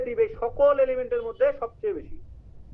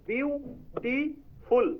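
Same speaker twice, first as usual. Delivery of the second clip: Beautiful drawn out slowly, syllable by syllable, pitch raised; 5 semitones higher, much slower than usual. Shown as a demonstration. Teacher showing the three syllables of beautiful slowly. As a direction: stretch beautiful out so each syllable is heard. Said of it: "তিনটা syllable".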